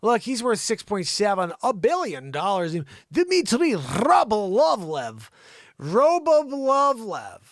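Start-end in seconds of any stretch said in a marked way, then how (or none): 3.1-7.4 drawn-out, high and loud name; mock-grand slow announcement; hammed-up name. Hamming up the oligarch's name.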